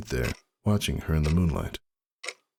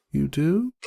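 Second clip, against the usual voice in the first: higher, puzzled mare's question; surprised Roseluck; 13 semitones higher than usual. A narrator's reading. Roseluck, surprised that Ember already knows. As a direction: caught off guard, asking back.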